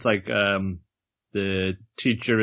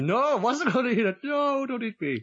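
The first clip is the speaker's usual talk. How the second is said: much higher, squeaky panicked plea; panicked squeal; 12 semitones higher than usual. Retold or imitated. Man swallowed by giant fish.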